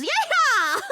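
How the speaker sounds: high, hammed-up cowboy whoop; a sarcastic carnival cheer; mock yeehaw. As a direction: whoop it like a rodeo rider. Mock carousel ride cheer.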